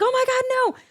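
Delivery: high-pitched horrified squeal; mock shriek of dismay; retold panic. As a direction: act out the moment of panic, pitch way up. Retelling her panic at the baby.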